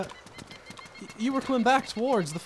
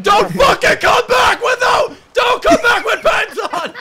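slow furious shout, much higher and louder, a laugh breaking through; angry shout; 10 semitones higher, 9 dB louder, slower than usual. Acted. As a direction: yell him out the door while half laughing. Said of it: "you got really angry".

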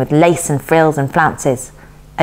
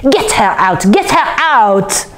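frantic, climbing repeated order; shrill, urgent cry; 6 semitones higher, slower than usual. A narrator's reading. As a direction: build to a high, drawn-out final cry. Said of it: "shrill and urgent".

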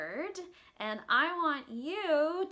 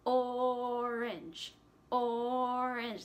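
orange said in two even beats; or-ange, evenly split; 2 semitones lower, much slower than usual. Shown as a demonstration. Teacher leads kids in saying orange, two beats. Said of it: "Let's say that together".

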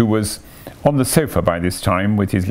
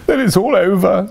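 high, louder tearful wail; sobbing; 10 semitones higher, 4 dB louder than usual. A narrator's reading. Toad crying into the sofa cushions, acted sobbing. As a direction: wail it in hammed-up despair. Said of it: "sobbed the Toad".

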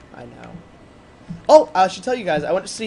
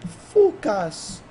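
put-on higher, louder Italian-accented outburst; Italian-accented English; 5 semitones higher, 5 dB louder than usual. Gamer quoting his Italian teacher, mock Italian accent. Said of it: "English with her accent".

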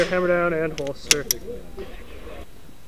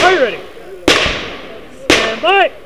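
loud flat safety commands; 7 semitones higher, 6 dB louder than usual. Real, not acted. Range officer start commands.

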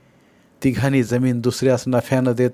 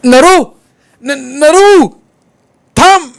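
very loud, high-pitched cry; full-force urgent call; desperate shout; 13 dB louder, much slower than usual. A narrator's reading. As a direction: yell after him to stop, stammering the name. Stricken man cries after his son.

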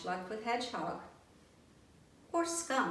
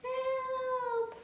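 high thin call from far off; small voice calling from afar; distant cry for help. Acted. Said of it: "came the cry again".

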